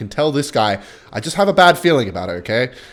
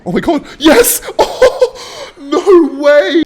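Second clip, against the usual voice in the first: high, loud shrieking celebration; elated disbelief; 6 dB louder, much slower than usual. Real, not acted. Player pulling off the defense, elated screaming. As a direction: shriek and laugh in amazed delight.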